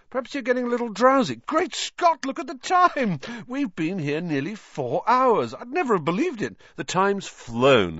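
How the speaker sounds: very high, excited prattle; flustered exclaiming. A narrator's reading. Vain teacher noticing the late hour, amazed. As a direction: gush with amazement at the clock.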